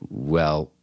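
ordinary delivery

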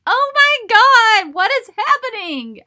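loud, very high, drawn-out wail of disbelief; acted shriek of exasperation; exasperated shout. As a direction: cry out in hammed-up despair. Acted despair during the race.

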